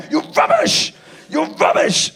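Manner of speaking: high, loud yelling at the comedian; angry heckler shout. Retold or imitated. Angry man yelling at the comedian after a bad gig. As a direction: bellow it like an enraged punter. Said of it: "he shouted at me".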